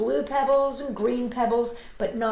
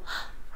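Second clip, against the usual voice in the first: soft gasp; 7 dB quieter than usual. Acted. Spotting the pebble.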